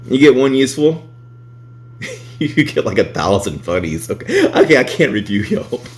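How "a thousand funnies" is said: ordinary delivery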